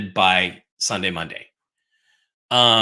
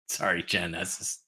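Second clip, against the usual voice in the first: ordinary delivery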